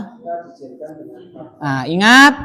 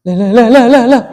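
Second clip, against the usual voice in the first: surprised protest; 4 semitones higher, 8 dB louder, faster than usual. Real, not acted. Teacher reacts to student's error.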